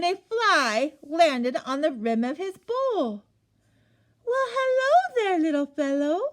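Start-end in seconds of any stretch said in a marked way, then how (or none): 4.3-6.2 higher, bright calling out; cheerful call. Acted. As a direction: greet the fly brightly. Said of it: "called out cheerfully".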